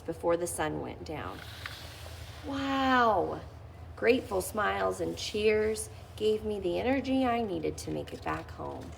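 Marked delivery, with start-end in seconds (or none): none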